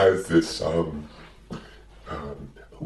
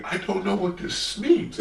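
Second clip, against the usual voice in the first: Big Bird; 10 semitones higher than usual. Acted. Big Bird voiced in a joke.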